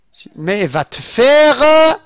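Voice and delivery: loud, high exclamation, last word stretched; frustrated outburst, slow and loud; exasperated shout. Real, not acted. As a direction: cut off a curse with a rising, drawn-out yell. Exasperated at the slipping mouse.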